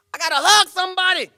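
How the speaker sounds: high, loud eager cry; high loud excited shout. Retold or imitated. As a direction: burst out with joy. Celebration urge.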